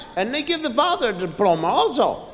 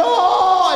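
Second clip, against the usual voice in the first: labor moan; 7 semitones higher, 4 dB louder than usual. Retold or imitated. Wife in labor in car.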